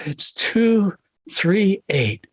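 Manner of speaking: imitated sigh, then very slow digits; weary reluctant answer. Wife called at midnight for the combination, mock weary. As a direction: sigh and give each number slowly, as retold.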